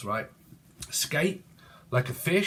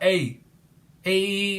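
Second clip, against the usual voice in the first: put-on Manchester accent; 6 semitones higher, 3 dB louder than usual. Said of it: "could be from fucking Manchester".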